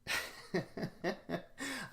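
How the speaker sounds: opening burst of laughter; real laugh to start. Real, not acted.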